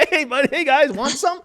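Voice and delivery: friendly Al Roker. Retold or imitated. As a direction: greet them like a jolly weatherman with his mouth full, laughing.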